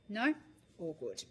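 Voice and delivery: ordinary talk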